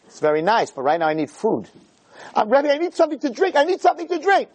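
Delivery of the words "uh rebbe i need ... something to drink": begging for a drink. Retold or imitated. Thirsty man begs rebbe.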